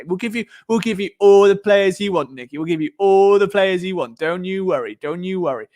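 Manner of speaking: mock reassurance, leaning hard on the promises; mock pampering. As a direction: gush indulgently as if spoiling a child. Voices bosses indulging the coach.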